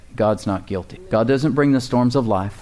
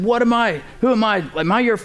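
higher, exasperated shout at the crowd; angry yell; 9 semitones higher than usual. Retold or imitated. Moses yelling at the people of Israel in anger. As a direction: vent like a man losing his temper. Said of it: "he yelled at the people".

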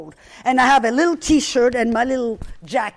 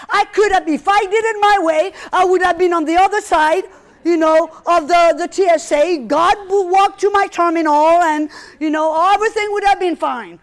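exasperated, raised and louder, complaining to God; frustrated complaint; 4 semitones higher, 5 dB louder than usual. Retold or imitated.

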